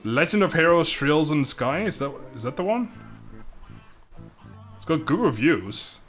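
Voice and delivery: ordinary talk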